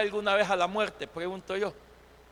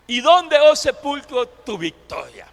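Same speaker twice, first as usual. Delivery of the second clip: ringing rhetorical challenge; defiant; 2 semitones higher, 5 dB louder than usual. Acted. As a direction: taunt the grave, dropping on the last words. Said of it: "Pablo le dice a la muerte desafiante".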